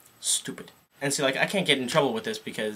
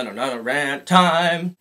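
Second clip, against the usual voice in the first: slow, loud drawn-out rant time; slow, loud show-host call; showy rant announcement; 4 dB louder, much slower than usual. Shown as a demonstration. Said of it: "no, no, no, no, no".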